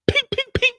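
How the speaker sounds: very high, sharp ping sounds; high pinging gunfire noises; silenced gunshots. Retold or imitated. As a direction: mimic a silencer firing. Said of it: "That's the sign of the silencer".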